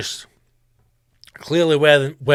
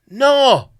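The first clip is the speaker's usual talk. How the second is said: emphatic no; 9 semitones higher, 7 dB louder than usual. Acted. Answering his own imagined question.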